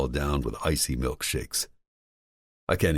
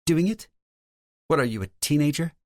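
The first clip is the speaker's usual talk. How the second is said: higher, lightly voiced woman; female character voice; 13 semitones higher, faster than usual. A narrator's reading. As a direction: play Lauren, higher pitch.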